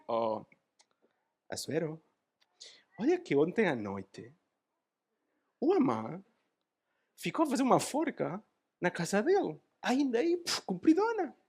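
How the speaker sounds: hushed, soft telling, rising high at the end; eunuch's report. Acted. Eunuch quietly informing the king, then rising high. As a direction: lean in and tell the king a secret. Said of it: "E chega lá um eunuco".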